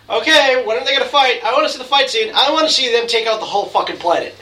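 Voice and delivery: high, loud eager fan; impatient excitement. Retold or imitated. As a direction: whine for the fight like a hyped kid. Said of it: "because I was like".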